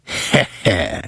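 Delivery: low, soft sinister chuckle; evil cackle. A narrator's reading. Horror host cackling at his grim tale, low evil chuckle.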